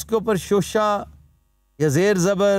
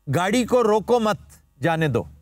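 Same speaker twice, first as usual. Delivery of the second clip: "रोको मत" as one phrase, pause, then "जाने दो"; pause after मत. Shown as a demonstration. Teacher showing how punctuation changes meaning, pause after "मत". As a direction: break after "मत", letting "जाने दो" drop. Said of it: "It is always the punctuation".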